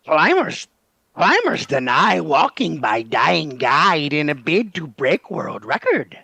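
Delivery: sing-song storybook imp, slow and drawn out; slow, lilting fairy-tale trickster; Rumpelstiltskin voice. Acted. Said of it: "how Rumpelstiltskin would say that".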